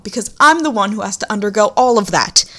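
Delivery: usual speaking voice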